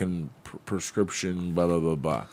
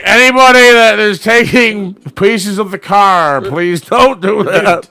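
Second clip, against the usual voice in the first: loud, slow, drawn-out announcement; event announcer; 3 semitones higher, 8 dB louder, slower than usual. Retold or imitated. Officials tell everyone to stop taking pieces, imitated announcer. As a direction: make a public plea over the loudspeaker, word by word. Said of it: "And they're like".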